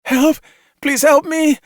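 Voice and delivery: high, loud desperate cry for help; screaming. A narrator's reading. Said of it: "she screamed".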